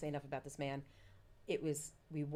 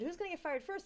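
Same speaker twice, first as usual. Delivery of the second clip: much higher, challenging question; pointed question; 11 semitones higher than usual. Retold or imitated. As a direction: put it to him sharply. Asking husband rhetorically.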